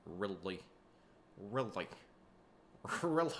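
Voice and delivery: exaggerated Minnesota accent on "really"; Minnesotan. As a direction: stretch each "really" in a mock Minnesota accent. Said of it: "Some people from Minnesota are".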